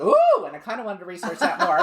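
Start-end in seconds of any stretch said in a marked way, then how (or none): 0.0-0.4 high, loud intrigued "ooh"; thrilled little ooh; excited ooh. Retold or imitated. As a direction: squeal with curiosity. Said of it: "And I was like".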